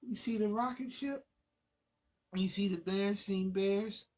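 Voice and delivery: ordinary delivery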